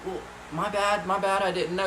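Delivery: imitated kid apologising; meek apology. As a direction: back down meekly as a scolded kid. Boy apologising to angry guard.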